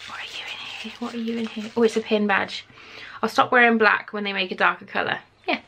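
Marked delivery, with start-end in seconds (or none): 0.1-1.7 whisper. Real, not acted. Whispering to the box.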